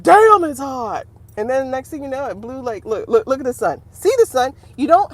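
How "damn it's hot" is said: loud, drawn-out mock complaint; overheated AC talking. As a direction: play an air conditioner loudly giving up in the heat. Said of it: "blinked off ... it was like".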